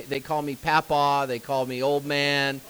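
ordinary talk

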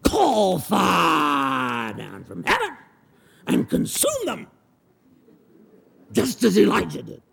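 slow, high, fervent demand; zealous demand. Acted. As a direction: cry out for fire, zealously. Disciples demanding fire from heaven.

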